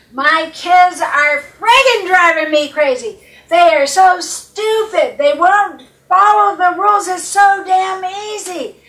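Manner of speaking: loud, high, exasperated complaining; frustrated mom rant. Retold or imitated. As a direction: rant like a fed-up mother at the end of her rope. Client venting angrily, mimicked.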